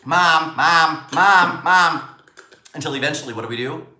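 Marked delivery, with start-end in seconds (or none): 0.0-2.0 higher, louder, faster-coming calls; nagging child. Acted. Kids demanding the parent's attention at last, nagging. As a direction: escalate the pestering, loud and pitched up.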